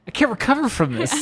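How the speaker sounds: high, laughing mock despair; laughing mock overwhelm. Host laughs at guest's story, mock overwhelmed and laughing. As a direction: laugh through a pretend breakdown.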